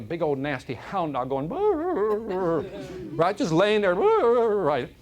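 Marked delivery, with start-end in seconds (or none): 1.5-2.6 high whiny dog groan. Retold or imitated. 4.0-4.6 loud whiny dog groan. Retold or imitated.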